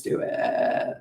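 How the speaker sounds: creaky drawl trailing out; vocal fry. Shown as a demonstration. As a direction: let the ending dissolve into a long creak. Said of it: "I can't stand a vocal fry".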